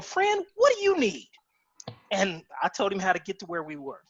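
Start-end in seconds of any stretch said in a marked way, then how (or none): 0.0-1.3 high-pitched, hammed-up Texan friendliness; matching Texas energy. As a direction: answer the Texan in his own twang. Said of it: "So he was like".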